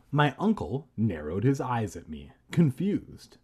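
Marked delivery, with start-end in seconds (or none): none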